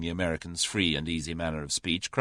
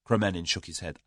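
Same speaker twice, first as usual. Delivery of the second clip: plain reading voice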